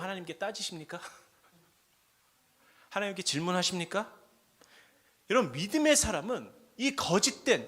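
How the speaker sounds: normal talking voice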